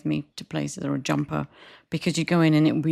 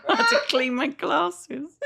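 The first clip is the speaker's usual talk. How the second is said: laughing; 10 semitones higher, 4 dB louder than usual. Real, not acted. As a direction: laugh it out while telling. Laughing at gross story.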